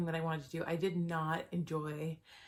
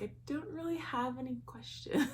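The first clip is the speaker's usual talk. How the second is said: amused; 8 semitones higher than usual. Retold or imitated. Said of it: "I'm like".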